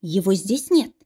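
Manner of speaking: ordinary delivery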